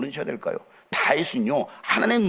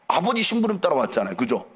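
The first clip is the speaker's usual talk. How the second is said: usual speaking voice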